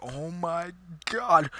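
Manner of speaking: slow shocked gasp of words; horrified. Retold or imitated. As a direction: stare at the broken arm in shock. Sees indent in arm.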